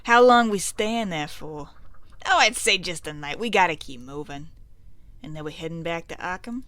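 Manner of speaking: lightly voiced mobsters making plans; a hint of old-time gangster; mafia guys talking. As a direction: trade lines like small-time crooks, lightly. Voices mobsters in game dialogue.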